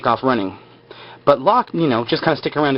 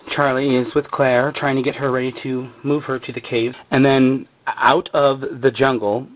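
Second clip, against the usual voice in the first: ordinary talk, nothing marked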